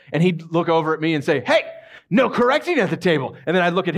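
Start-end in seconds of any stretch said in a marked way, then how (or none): 1.5-3.4 high-pitched bossy comeback; mock sibling retort. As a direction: fire the rule back, playfully. Brother retorting in sibling quarrel.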